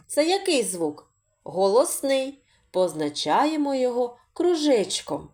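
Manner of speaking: normal talking voice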